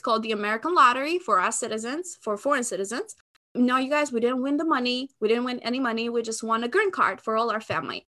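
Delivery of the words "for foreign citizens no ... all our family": ordinary talk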